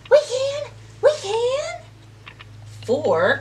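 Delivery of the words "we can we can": high, bright eager call; eager answer. Acted. Fish answer the stuck duck, eager high call. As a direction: cheer it out as helpers.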